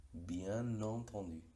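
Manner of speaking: "Bien entendu" said very slowly, N linked into entendu; slow N liaison. Shown as a demonstration. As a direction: drag out "Bien entendu", sounding the N. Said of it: "con la liaison aparece su sonido". ("Bien entendu" muy lento, enlazando la N)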